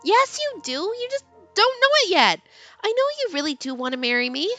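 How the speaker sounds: put-on high, stubborn teen girl; high girly voice. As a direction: insist he secretly wants it. Heroine voiced in read story.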